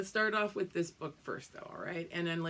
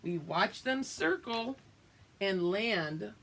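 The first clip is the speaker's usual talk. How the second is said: put-on reading to children. Reading picture book aloud.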